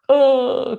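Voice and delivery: a sudden high wail of grief; high, loud crying wail. Retold or imitated. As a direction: cry out as the kids did. Kids crying at dad's words.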